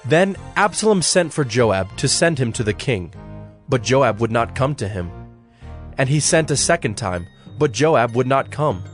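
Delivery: ordinary talk, nothing marked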